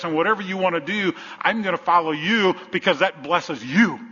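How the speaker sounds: high, loud, driving sermon delivery; full-force preaching. Real, not acted. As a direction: preach it out with rising insistence.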